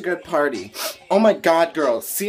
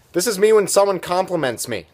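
ordinary talk

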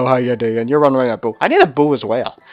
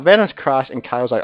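normal talking voice

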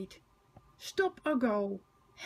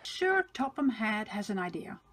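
plain reading voice

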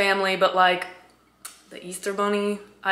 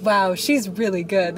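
Camp Rock quote; slower than usual. Retold or imitated. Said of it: "in Camp Rock where she's like".

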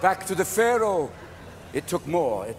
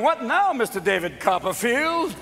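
sarcastic doubter; 2 semitones higher than usual. Acted. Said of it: "then they're going".

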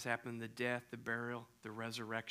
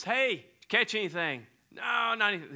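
loud, high call across the water; 8 semitones higher, 8 dB louder than usual. Retold or imitated. Jesus and the disciples calling across the water, loud call. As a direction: call out to the boat from the shore.

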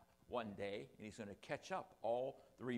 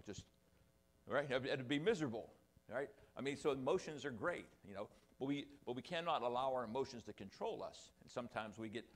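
normal talking voice

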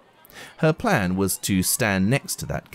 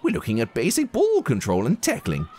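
higher, quick burbling teacher voice; 7 semitones higher, faster than usual. Acted. Teacher burbling instructions to the class, high and fast. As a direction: rattle it off like a distracted teacher. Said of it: "Miss Honey burbling away".

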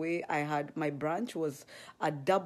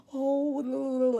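delighted gasp; 9 semitones higher, 5 dB louder than usual. Retold or imitated. Delighted at shoe heaven.

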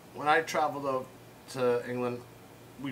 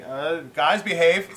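louder real scolding aside; calling out; 4 semitones higher, 7 dB louder, slower than usual. Real, not acted. Owner to animals acting up, calling out. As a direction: call the pets to order.

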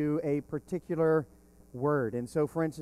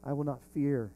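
soft assurance; 2 semitones lower, 6 dB quieter than usual. Retold or imitated. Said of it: "And yet he said".

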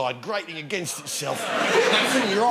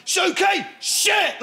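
high, loud dog command; shouted command; 13 semitones higher, 4 dB louder, much slower than usual. Retold or imitated. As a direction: bark the order at the dog. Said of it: "he goes".

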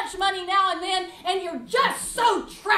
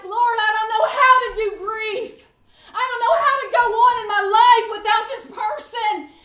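grieving prayer; 4 semitones higher, 8 dB louder than usual. Retold or imitated. Acted prayer in grief.

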